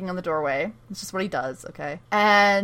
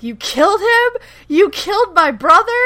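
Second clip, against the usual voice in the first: mock outrage, much higher pitch; hammed-up indignant questioning; outraged accusation; 9 semitones higher, 5 dB louder than usual. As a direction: imitate a shocked, furious accuser. Retold accusation of killing her brother.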